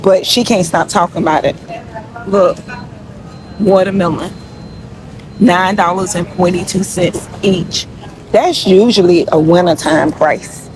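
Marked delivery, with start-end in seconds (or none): none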